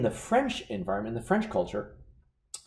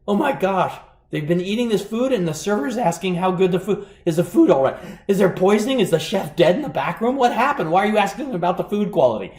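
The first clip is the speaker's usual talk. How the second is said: alarmed European diner; 5 semitones higher, 6 dB louder than usual. Retold or imitated. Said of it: "The European has the focus".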